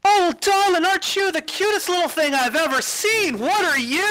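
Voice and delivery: high, gushing festival organizer; very high doting lady voice; gushing coordinator. Acted. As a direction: coo over the cute little creature.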